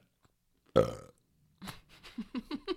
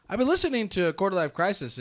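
louder, higher dad voice; dad impression; 7 semitones higher, 6 dB louder than usual. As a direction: play the dad announcing it. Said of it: "Your dad's gonna be like".

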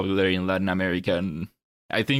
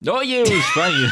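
laughing while answering; high bubbly laugh through words; 5 dB louder, faster than usual. Real, not acted. Amused answer, laugh heard.

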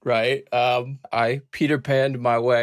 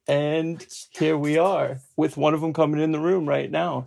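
ordinary talk, nothing marked

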